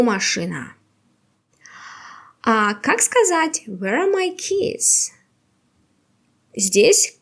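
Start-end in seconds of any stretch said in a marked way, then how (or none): none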